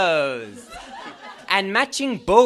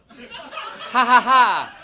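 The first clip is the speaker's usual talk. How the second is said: slow, loud, higher villain cackle; slow evil laugh; 4 semitones higher, 6 dB louder, much slower than usual. Acted. Fanfic reader cackling before the reading.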